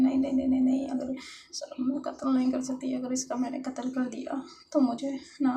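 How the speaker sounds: loud, high, agitated self-argument; frantic inner protest. Acted. Jealous lover backing off her imagined murder, frantic protest.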